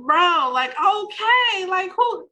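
high, incredulous "who?"; exasperated disbelief. Retold or imitated. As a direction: act baffled and annoyed. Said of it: "I'm like".

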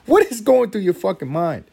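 high, loud repeated outburst. Retold or imitated. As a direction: push the disbelief further. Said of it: "I'm like".

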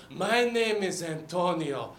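put-on slow, weary shark with Mexican accent. Sad old shark to bartender at last call, Mexican accent. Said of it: "I gave the shark a Mexican accent".

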